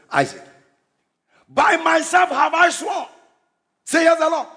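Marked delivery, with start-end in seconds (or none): 1.5-4.5 solemn scripture reading. Acted. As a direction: proclaim God's oath like a herald.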